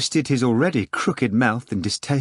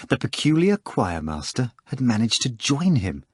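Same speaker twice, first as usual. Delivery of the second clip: normal talking voice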